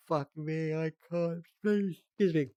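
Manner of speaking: reading through a rising yawn. Real, not acted. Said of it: "Yeah, I'm yawning because I".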